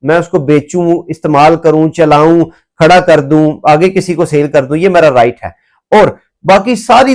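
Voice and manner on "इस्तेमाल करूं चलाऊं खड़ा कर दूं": normal talking voice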